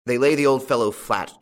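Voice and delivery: plain reading voice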